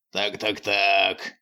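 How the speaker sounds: slow villain drawl through teeth; through gritted teeth. A narrator's reading. Villain greets the prince, slow and through his teeth. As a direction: savour each word like a villain. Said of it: "сквозь зубы процедил он".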